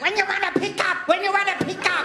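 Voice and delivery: mock Korean accent, repeating the question; imitating Korean dry cleaner. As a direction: fire the question twice like a pushy shopkeeper.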